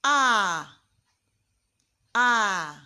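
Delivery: A said loud as the French open "a". Shown as a demonstration. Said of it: "We sound it as".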